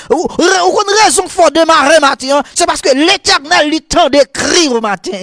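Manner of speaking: high, fast-building revival sermon; full-force preaching. Real, not acted. Preacher warning the church about sin, full-force preaching. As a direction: drive each phrase high, then drop on the ending.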